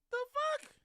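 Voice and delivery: high, squeaky "the fuck?"; squeaky disbelief. Real, not acted. Reacting to Bodine not speaking Spanish, high squeaky disbelief. Incredulous outburst.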